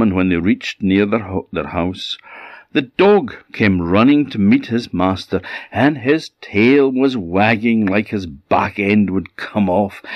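ordinary delivery, nothing marked

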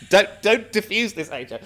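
don't let her off, urgent and high; excited plea. Retold or imitated. Telling friend not to defuse it, high loud mock plea. His own retort retold.

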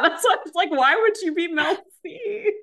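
high, loud, laughing disbelief; real cracking-up incredulity. Real, not acted. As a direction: squeal it through laughter. Cracking up at co-host's mistake.

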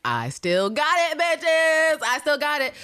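high, loud victory crow; gloating boast. Retold or imitated. Constantine gloating after surviving the stairs. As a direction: crow it like he's back on top. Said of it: "He was like".

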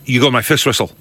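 a light Scottish referee impression; lightly put-on Scottish accent. Recalling the old Scottish referee from Gladiators, Scottish accent. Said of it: "can you do a Scottish accent?".

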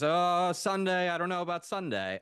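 high-pitched, hesitant imitation of the booking lady; imitating strong attitude. Booking lady put on the spot about Sunday, imitated with attitude. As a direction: put on her high, doubtful tone, no accent. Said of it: "this is the way she said it".

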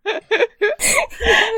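high, helpless laughing; real giggling fit. Real, not acted. Player cracks up at her cat.

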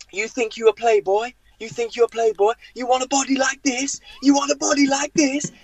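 high-pitched mock accusing taunt; hammed-up indignant rant; seething manager. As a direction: imitate a raging boss sneering at a player. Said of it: "seething, ... breathing fire".

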